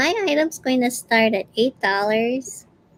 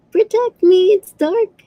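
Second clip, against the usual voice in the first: scared little owl; 8 semitones higher, 7 dB louder than usual. Acted. Owl voiced on a craft.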